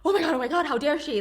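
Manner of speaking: high, loud scandalised gasping; imitating the shocked public's "how dare she"; mock outrage. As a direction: play the outraged public clutching pearls. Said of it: "there was just this intense".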